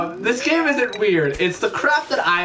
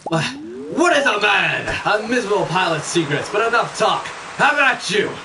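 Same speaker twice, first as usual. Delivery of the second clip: grandiose declamation, pitched up at the peaks; theatrical villain; 4 semitones higher than usual. Acted. Acting out a famous villain's speech.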